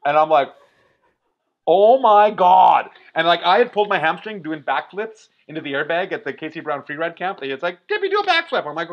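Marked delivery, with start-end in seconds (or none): 1.7-2.9 long, drawn-out loud exclamation; slow, loud retold relief; retold awestruck cry. Reacting to daughter's ride. 7.9-8.7 high-pitched imitated call; imitating an eager shout-out, pitched high; retold urging. Quotes someone egging on daughter.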